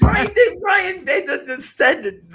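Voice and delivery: high, loud laughing outburst; laughing shout. Real, not acted. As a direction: yell it through laughter. Laughing protest at being called idiot.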